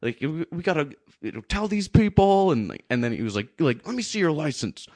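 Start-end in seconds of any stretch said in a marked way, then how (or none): 0.0-2.5 high-pitched imitation of an angry man; mock an irate man making demands; real mad driver. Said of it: "he got real mad". 3.9-4.9 higher-pitched mock interrogation; real mad driver. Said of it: "he got real mad".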